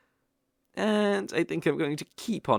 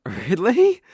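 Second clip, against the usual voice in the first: delighted surprise; 6 semitones higher, 9 dB louder than usual. Acted. Surprised at honest answer.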